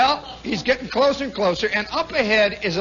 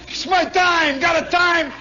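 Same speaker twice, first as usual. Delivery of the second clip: flustered; 8 semitones higher, 4 dB louder than usual. Retold or imitated. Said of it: "driver explaining to cop".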